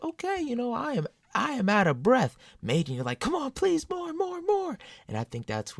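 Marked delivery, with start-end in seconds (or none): 0.0-2.4 high, put-on spent voice; mock exhausted fan. Comparing Metallica show, mock spent fan voice. 3.2-4.8 very high pleading voice; mock begging fan. Wanting Maiden never to end, high mock pleading.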